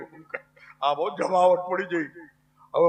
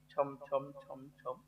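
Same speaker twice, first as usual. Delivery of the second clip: very soft, low anklet chimes; imitated anklet jingle; 7 semitones lower, 19 dB quieter, much slower than usual. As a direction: echo distant anklets, barely audible. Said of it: "એમાં ઝાંઝરના અવાજ સંભળાણા". (ઝાંઝરનો ધીમો છમ છમ અવાજ)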